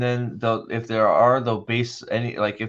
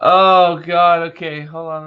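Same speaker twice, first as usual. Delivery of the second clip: breathless after laughing; 5 semitones higher, 9 dB louder, slower than usual. Real, not acted. Recovering from laughing fit.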